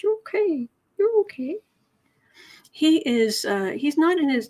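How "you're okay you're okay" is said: high, cooing reassurance; soothing. Real, not acted. Calming someone growling in a nightmare, high soothing voice. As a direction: comfort a dreaming pet in a high voice.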